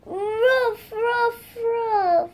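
three high pretend puppy barks. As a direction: bark ruff like a toy puppy. Playing a toy puppy barking.

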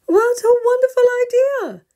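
high, loud glad exclamation; delighted mother bear. Acted. Mother bear praising Grandpa's picnic plan, high and delighted. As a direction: gush over the idea.

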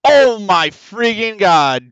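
loud, slow, drawn-out exasperation; exasperated groan. Real, not acted. Stuck player groaning at the game, loud and slow. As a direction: drag it out, fed up. Gamer furious at stuck puzzle.

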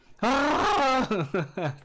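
high loud growling Chewbacca yell; mock Chewbacca roar. Streamer attempts a Chewbacca noise for chat, hammed-up roar. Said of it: "I suck at that Chewbacca noise".

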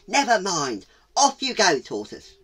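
loud, high dismissal; brisk owl. Acted. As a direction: send the tortoise on its way. Owl sends tortoise off.

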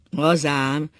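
loud, scornful agreement; a loud, scoffing "so it is"; sarcastic. A narrator's reading. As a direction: sneer it out. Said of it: "szólt Ákos gúnyosan".

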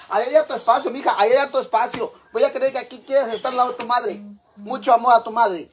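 high-pitched, exasperated telling-off; scolding. Real, not acted. Telling Nayeli there is plenty of space, high scolding. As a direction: scold Nayeli with sarcastic insistence.

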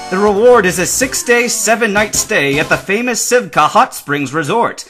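loud, high, emphatic pitch; urgent appeal. A narrator's reading. Worker seeks a Pokemon trainer's help, loudly urgent. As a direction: press the appeal with force.

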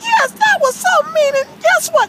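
high-pitched worked-up puppet voice; indignant character rant; vengeful Frankie. Acted. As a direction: threaten revenge like a cartoon character. Frankie vows revenge.

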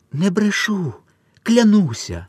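high, pleading oath. A narrator's reading. Mother swears to German soldiers, high and pleading.

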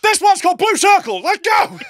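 high, loud hammed-up announcement; mock hype shout. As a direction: yell it like kicking off a number. Acts out a performer launching number.

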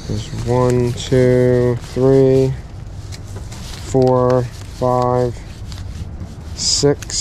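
ordinary talk, nothing marked

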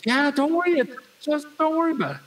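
high, hurried put-on voice; imitated high-voiced reassurance; reassuring valet impression. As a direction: brush off worries as the valet, pitched high.

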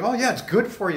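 lightly voiced coworker brushing it off; imitating Chi. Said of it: "But he's like".

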